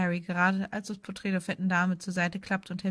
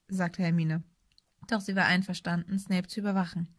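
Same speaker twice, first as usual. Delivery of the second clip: ordinary delivery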